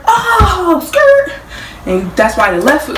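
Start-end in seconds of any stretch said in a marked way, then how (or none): 0.1-1.3 high "oh" with screeching tire noise; swerving yelp. Shown as a demonstration. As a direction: cry out high, then imitate a skid. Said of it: "You swerve".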